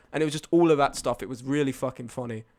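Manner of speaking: normal talking voice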